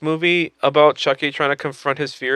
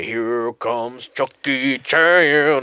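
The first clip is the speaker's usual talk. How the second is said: movie announcer; much slower than usual. Retold or imitated. Announcer-style movie line.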